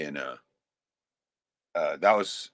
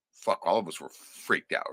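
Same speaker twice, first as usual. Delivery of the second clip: ordinary talk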